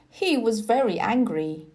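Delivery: plain reading voice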